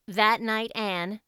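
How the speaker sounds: ordinary delivery, nothing marked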